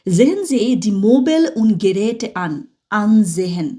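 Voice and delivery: slow, careful German read aloud; slow model reading. Shown as a demonstration. Teacher modelling the book's German for students, slow. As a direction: read "Sehen Sie die Möbel und Geräte an" slowly for the class. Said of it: "reads book instruction aloud".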